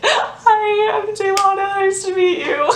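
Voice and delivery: high, slowed, overly polite hello; sweet high voice. Retold or imitated. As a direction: greet her sweetly and a bit slowly. Her own timid greeting.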